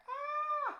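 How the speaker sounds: very high loud gasp; mock gasp. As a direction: gasp in pretend shock.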